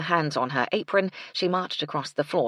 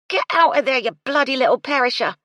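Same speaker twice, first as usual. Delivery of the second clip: much higher, much louder furious yell, fast; 9 semitones higher, 9 dB louder, faster than usual. A narrator's reading. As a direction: bellow at the cat. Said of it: "she yelled".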